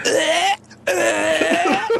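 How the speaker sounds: high strained retching noises; mock gagging. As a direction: act out forcing yourself to puke. Imitates Bailey straining to puke.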